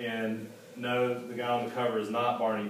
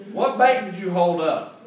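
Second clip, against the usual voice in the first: much louder, higher startled question; shocked salesman; 6 semitones higher, 10 dB louder than usual. A narrator's reading. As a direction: blurt it out stunned.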